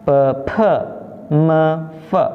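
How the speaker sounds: evenly spaced "P, P, me, fe" with schwa endings; pinyin sound drill. Shown as a demonstration. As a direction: model "P, P, me, fe" as isolated syllables. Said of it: "tulisan cara bacanya".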